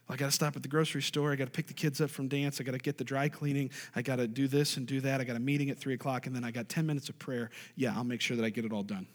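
hurried monotone checklist of errands; rushed to-do list. Acted. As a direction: rattle it off fast, low and even. Voicing a busy person's rushed list.

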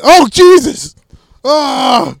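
doctor yelping high and loud in horror; shocked doctor. Pretend. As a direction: recoil as a doctor at a gross sight. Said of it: "doctor's gonna be like".

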